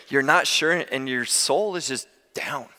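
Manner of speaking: normal talking voice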